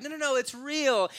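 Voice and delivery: high, pleading mock protest; earnest insistence. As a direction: insist as the women, voice shooting up. Women insist to disbelieving men.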